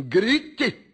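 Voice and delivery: father's spirit urges his choked-up son, shouting; urging shout. Retold or imitated. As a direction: cry it out with joyful urging. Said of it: "E ele disse assim".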